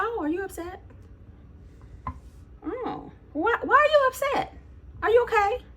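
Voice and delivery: high, sugary mock concern; nasty nice. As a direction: coo passive-aggressively at an upset stranger. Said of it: "being nasty nice, as I like to call it".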